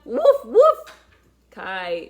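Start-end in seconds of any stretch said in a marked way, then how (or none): 0.0-0.8 two loud, much higher dog barks; high playful woofs. Acted. Cheering on Kai and the wolf clan, high loud woofs. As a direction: yip like an excited pup.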